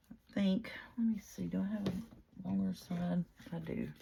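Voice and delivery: whispered aside to herself; breathy muttering while checking the paper; whisper to self. Real, not acted. Talking to herself while crafting.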